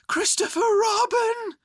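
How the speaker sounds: high, loud, drawn-out hissed call; loud whisper. A narrator's reading. Bear up high to boy below, loud stage whisper. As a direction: call down urgently, high and slow. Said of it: "he said in a loud whisper".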